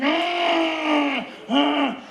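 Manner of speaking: long high "ah", then a short one; hammed-up pleasure scream; acted ecstatic cry. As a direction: wail as if overwhelmed. Acting own cry in sex.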